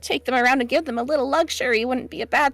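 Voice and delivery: high, bright-eyed diner waitress; chirpy small-town waitress; cheerful waitress voice. Acted. GM voices Shelby.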